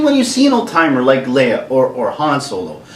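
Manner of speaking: ordinary delivery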